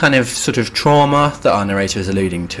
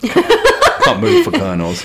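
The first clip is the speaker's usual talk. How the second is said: laughing; 3 dB louder, slower than usual. Real, not acted. Laughing joke about colonels.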